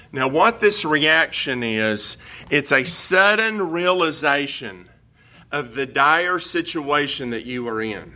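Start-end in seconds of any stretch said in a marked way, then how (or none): none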